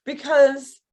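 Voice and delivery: plain reading voice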